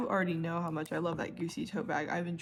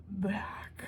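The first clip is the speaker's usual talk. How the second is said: dramatic whisper; 4 dB quieter than usual. Shown as a demonstration. Telling viewers the bag came back, whispered.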